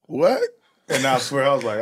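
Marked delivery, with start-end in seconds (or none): 0.1-0.4 stunned what. Retold or imitated. Shocked at heckler.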